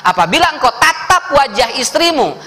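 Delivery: ordinary talk, nothing marked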